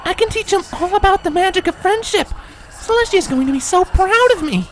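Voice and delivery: high, gushing, proud pony; eager to impress her mentor; excited Twilight. A narrator's reading.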